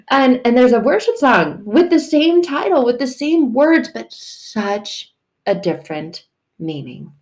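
usual speaking voice

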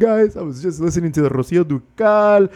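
high, lightly hammed weepy caller; mock sad caller. Imagined fan calls the hotline after Amor Eterno, in a high mock-weepy voice. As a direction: play a teary fan leaving a message. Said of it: "And you're like".

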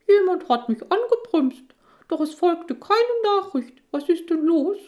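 very high, loud squeaky man's voice; big strong man with a tiny squeak. Acted. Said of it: "mit piepsiger Stimme fragt".

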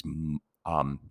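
ordinary talk, nothing marked

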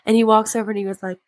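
ordinary talk, nothing marked

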